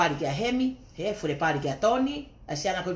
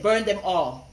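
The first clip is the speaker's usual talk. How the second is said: usual speaking voice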